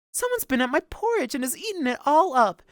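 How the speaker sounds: high, tiny bear voice; loud little high-pitched wail; wee little bear. A narrator's reading. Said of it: "in his wee little voice".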